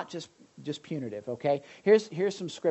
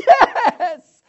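much higher and louder, with a laugh; squealing child; 16 dB louder than usual. Retold or imitated. Son answering his father, squealing laugh. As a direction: yelp it like a stung little boy.

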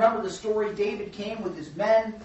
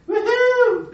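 put-on a very high, loud drunken woohoo; mock party whoop; 10 dB louder than usual. Preacher imitating Nabal partying like a king, loud whoop.

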